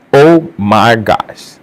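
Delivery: very slow, drawn-out oh my gosh; slow stunned repeat. Real, not acted. Vlogger reeling at the surgery story, slow and stunned. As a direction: let it sink in, stretched slowly.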